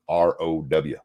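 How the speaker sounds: ordinary talk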